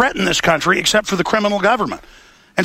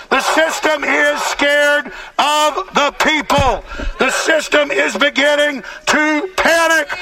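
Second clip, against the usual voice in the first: rising fiery rally oration; rally speech; 8 semitones higher, slower than usual. Real, not acted. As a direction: preach it to the protesters, building up.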